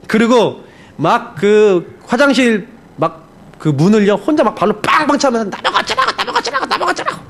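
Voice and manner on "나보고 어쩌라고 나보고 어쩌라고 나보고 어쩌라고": high, strained, fast repeated outburst; angry tantrum. Retold or imitated. Pastor kicking the bathroom door alone, high angry tantrum. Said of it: "막 신경질이 나고 화가 나니까요".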